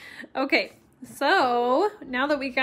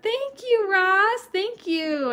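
excited thanks; 9 semitones higher, 5 dB louder, slower than usual. Real, not acted. Thanking a viewer.

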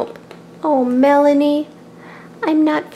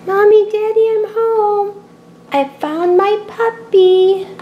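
excited calling; 8 semitones higher, 3 dB louder, slower than usual. Acted. Child calling parents on arriving home.

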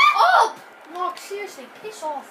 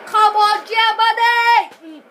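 loud, high cheer with a long-drawn finish; rallying shout; 5 semitones higher, 9 dB louder, much slower than usual. Real, not acted. Player cheers on Germany, full-voiced shout. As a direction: roar the team on, stretching the country.

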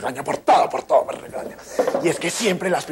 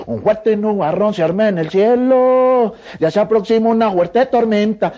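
louder and higher, slow and grand; loud slow dramatic line; 8 semitones higher, 7 dB louder, slower than usual. Retold or imitated. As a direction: declaim it like a storm warning. Imitación de la señora Milo bailando. (declamación alta y lenta, como anunciando tormenta)

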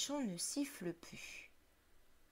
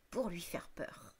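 whispering; 4 semitones lower, 6 dB quieter than usual. A narrator's reading. Narrator whispers the scary aim.

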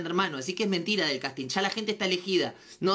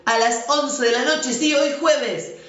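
loud, bubbly plug for tonight's live; excited promo announcement; 4 semitones higher, 7 dB louder than usual. Acted. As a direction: sell the live show with big energy.

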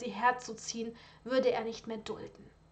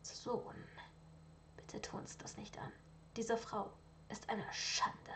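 soft, unvoiced pleading whisper; quieter; 13 dB quieter than usual. Father quietly begs son to drop the woman, whispered. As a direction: drop to a hushed plea. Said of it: "leiser wurde".

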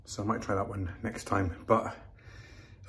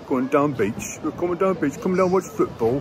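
put-on loud, high northern English accent; imitating a northern English lad; 6 semitones higher, 8 dB louder than usual. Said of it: "all I've heard today is northern voices".

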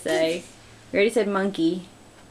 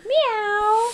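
high cat meow; 12 semitones higher than usual. Pretend. Echoing toddler's cat sound.

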